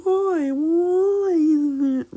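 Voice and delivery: put-on very high, slow girlish whine; Ochako. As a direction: stretch it out in a squeaky girl voice. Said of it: "Ochako asks him".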